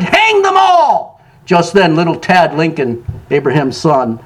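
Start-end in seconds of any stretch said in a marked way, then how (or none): 0.0-1.2 high, drawn-out mob cry; higher-pitched rallying shout, slow; angry crowd shout. A narrator's reading. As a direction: imitate an angry crowd calling out, stretching the words. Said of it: "The angry crowd shouted".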